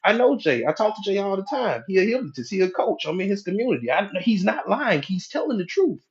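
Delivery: high-pitched indignant pleading; protesting conscious mind. Acted. Conscious mind arguing with the critical mind, high protesting voice. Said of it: "the conscious mind gonna use its logic".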